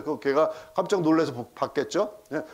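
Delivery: ordinary talk, nothing marked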